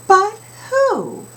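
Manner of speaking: chorus punchline, high and loud; high but who. Shown as a demonstration. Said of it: "Let's practice it".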